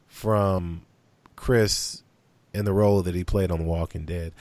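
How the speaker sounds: ordinary talk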